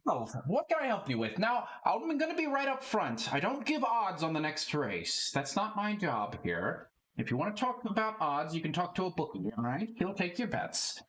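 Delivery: a barkeep setting the rules for strangers; blunt halfling bookmaker. Acted. Lot lays out terms.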